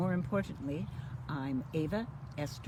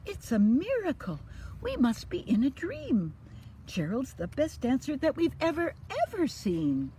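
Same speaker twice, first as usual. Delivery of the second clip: loud, higher amazed cheering; excited shout; 10 semitones higher, 7 dB louder than usual. Acted. Animals watching Gerald dance, loud amazed shout. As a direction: cheer in disbelief. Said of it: "They shouted".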